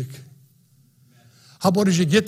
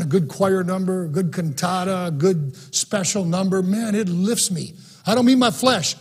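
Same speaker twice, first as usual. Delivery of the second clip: normal talking voice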